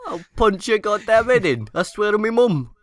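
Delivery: high caricature British yob; hammed-up British-accent threat; mock British threat. Joking threat among friends, British accent.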